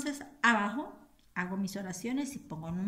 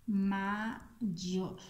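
"mayor" split into ma, pause, yor; dictating by syllables; 3 dB quieter than usual. Shown as a demonstration. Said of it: "Copiamos aquí".

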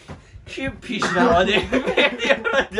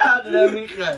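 trying not to laugh; 7 semitones higher, 5 dB louder than usual. Real, not acted. Try-not-to-laugh challenge, bursting.

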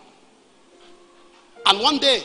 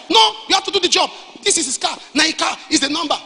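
much higher, louder shouting argument; heated shout; 9 semitones higher, 4 dB louder than usual. Retold or imitated. Gang members shouting that this is the car, loud and heated. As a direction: yell them down, insisting. Gang arguing loudly in retold scene.

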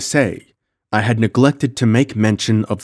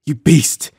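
higher, louder outraged cry; cried out; 8 semitones higher, 6 dB louder than usual. A narrator's reading. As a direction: fling the insult at him. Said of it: "she cried".

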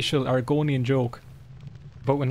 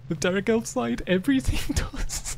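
much higher, breaking up laughing; laughing punchline; 9 semitones higher than usual. Real, not acted. Gamer reading a dirty joke, cracking up. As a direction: squeak it out giggling.

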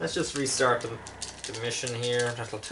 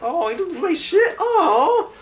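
very high, loud squeal of realisation; mock astonishment; 10 dB louder, slower than usual. Gamer retelling his subtitle discovery, high mock-shocked squeal. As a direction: squeal it out in exaggerated surprise. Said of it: "And I was like".

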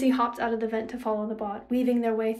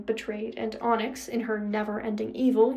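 plain reading voice

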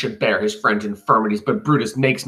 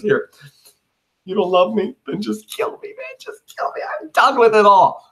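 much higher, hammed-up plea, dropping to a breathy hush on the second kill me; mock despair; 11 semitones higher, 3 dB louder than usual. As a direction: overplay a friend begging to be killed. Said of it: "and now he goes".

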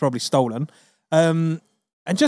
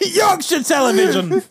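hammed-up reporter cry; shouted catchphrase; 10 semitones higher than usual. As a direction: bellow the sign-off.

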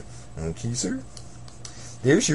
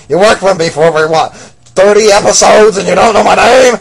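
indignant outburst; 3 semitones higher, 11 dB louder than usual. Acted. King Zorg loudly offended the clerk forgot his name. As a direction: demand it with wounded pride.

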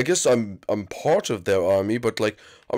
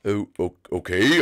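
normal talking voice

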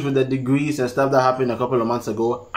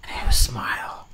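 Sonny Badu; 5 semitones lower, 5 dB quieter than usual. YouTuber showing the preacher calming, voice coming back down. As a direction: voice sinking into a low husky hush. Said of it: "his voice will come back down".

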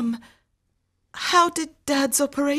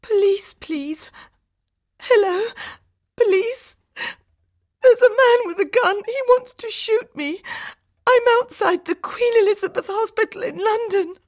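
very high, loud, desperate plea; tearful panic; 5 dB louder than usual. A narrator's reading. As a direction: cry frantically for help on an emergency call. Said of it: "Harminder started to cry".

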